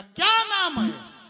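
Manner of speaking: slow, high, very loud robber's bark; booming bandit demand; menacing shout. Acted. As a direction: roar the question to scare her. Robber demands old woman's name.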